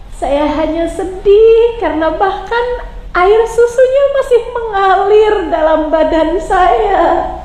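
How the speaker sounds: tearful lament. Acted. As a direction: let the grief crack through, slowly. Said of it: "dalam isak tangisnya".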